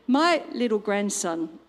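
ordinary talk, nothing marked

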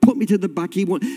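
usual speaking voice

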